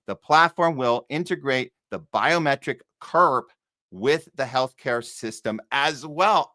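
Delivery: usual speaking voice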